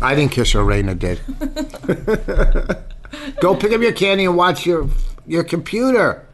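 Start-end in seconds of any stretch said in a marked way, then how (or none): 1.3-3.4 a burst of high laughter; high giggle. Real, not acted. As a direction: giggle up high, caught off guard. Laughing at the kiss joke.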